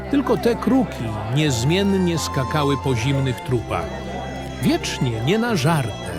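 ordinary delivery

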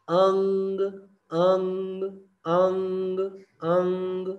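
अंग sung out high with a buzzing ng; nasal ng demo. Shown as a demonstration. Teacher drills the अंग vibration. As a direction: repeat अंग, let the ng vibrate in the nose. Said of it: "vibration".

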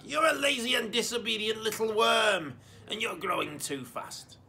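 put-on high, loud shrill old woman; screeching granny. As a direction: screech insults like a nasty grandma. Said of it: "the voice screeched back".

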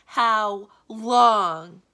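very loud, drawn-out cry of despair; desperate wail. A narrator's reading. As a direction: bellow it in despair, stretching each word. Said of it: "waved his arms in desperation".

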